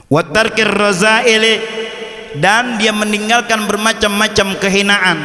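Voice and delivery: high, measured reading-out voice; kitab recitation. Acted. Recites kitab text and translates.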